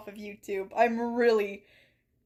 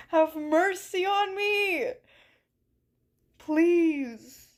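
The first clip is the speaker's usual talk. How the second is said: mock plea; 5 semitones higher, 3 dB louder, much slower than usual. Mock begging chat.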